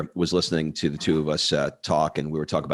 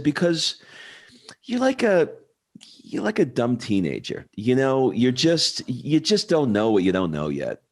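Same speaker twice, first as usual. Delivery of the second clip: put-on light Italian accent, pitched much higher; a hint of an Italian bartender's accent, voice pitched up; imitating a broken Italian accent; 6 semitones higher than usual. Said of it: "he said in his broken Italian accent".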